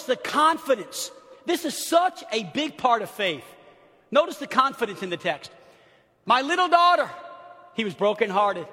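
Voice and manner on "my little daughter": high, loud anguished quote; desperate pleading. Acted. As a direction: cry it out as a father's plea. Quotes pleading father in text.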